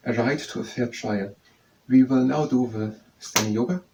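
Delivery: usual speaking voice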